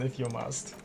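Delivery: soft, understated resigned tone. A narrator's reading. Reading out the lieutenant's reluctant consent, softly resigned. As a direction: give in quietly, with mild resignation. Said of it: "adds in a resigned tone".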